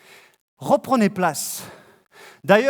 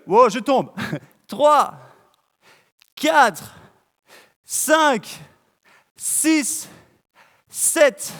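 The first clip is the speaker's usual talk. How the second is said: fitness coach counting; 2 semitones higher, much slower than usual. Acted. Leads live squat workout, counting.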